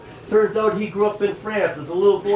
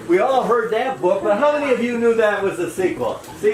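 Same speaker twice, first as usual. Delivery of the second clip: ordinary talk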